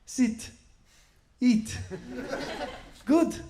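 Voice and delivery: put-on high, accented single words; halting foreign-accented English, high-pitched; imitated foreign relative. Relatives with a few English words, halting foreign accent. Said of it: "You just have a few words".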